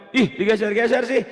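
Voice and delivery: high, loud exasperated whine; mock irritation. Teacher imitating an annoyed learner, exasperated. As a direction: act really fed up, voice jumping up. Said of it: "Kayak kesel banget gitu kan".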